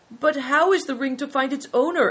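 put-on high, loud, puzzled boy's question; perplexed. A narrator's reading. Boy asks the abbot about the ring, perplexed. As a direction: voice climbing in bewilderment.

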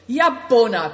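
loud call to move on; rally everyone to ride; crying out. A narrator's reading. The host urging the group to set off, crying out. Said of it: "cried Nai Dong".

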